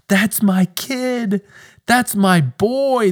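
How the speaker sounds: a delighted heavenly father bragging; overjoyed parent voice of God; proud God voice. Pretend. Voicing God's proud joy.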